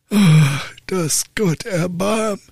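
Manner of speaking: high, slow wail of dismay; father groaning at his son's answer; dismayed old father. Acted. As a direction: cry out to heaven in despair.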